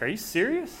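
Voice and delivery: a soft gasp of amazement; disbelief. Retold or imitated. Preacher recalling the team's offer, stunned and hushed. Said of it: "And I'm just like".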